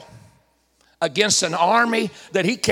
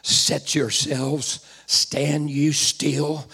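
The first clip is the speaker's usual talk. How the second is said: slow solemn command; 8 semitones lower, 5 dB quieter, much slower than usual. Acted. Prophet's command read slowly.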